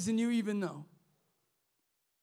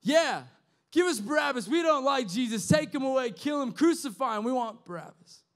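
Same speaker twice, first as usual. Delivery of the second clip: imitated mob yelling, higher and louder; preacher voicing the mob, louder and higher; crowd shouting; 5 semitones higher, 4 dB louder than usual. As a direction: call it out like an angry crowd demanding Barabbas. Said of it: "the people start shouting".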